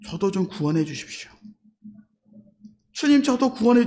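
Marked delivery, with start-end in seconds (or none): 0.1-1.3 softer, lower, hushed entreaty; humble plea. Acted. Dying robber confessing to Jesus, humble plea. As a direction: plead quietly and humbly. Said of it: "예수님께 고백합니다".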